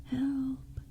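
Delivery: high, distant little cry; faint far-off shout; small voice shouting. Acted. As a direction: call out from inside a ringing bell. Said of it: "the small voice shouted".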